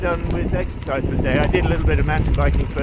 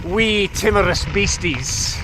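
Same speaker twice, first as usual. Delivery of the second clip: mock Scottish accent; 5 semitones higher, slower than usual. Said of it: "hard to say wee beasties without affecting a Scottish accent".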